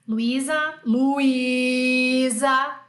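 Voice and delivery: long-held "Luísa", stretched on the middle; Brazilian mother calling. Acted. Mother calling her daughter in Portuguese, long loud call. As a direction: call out the name loudly, holding the í. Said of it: "Isso aqui em português".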